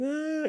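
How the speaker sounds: high, drawn-out mock wail; thin, high pretend cry; imitated crying wail. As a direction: mimic a kid bursting into tears. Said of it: "he starts crying".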